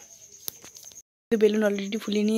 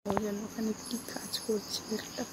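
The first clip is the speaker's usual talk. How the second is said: hushed whisper so others won't hear; 7 dB quieter than usual. Real, not acted. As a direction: keep it soft and secret. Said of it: "তাই আমি আস্তে আস্তে করে বলছি".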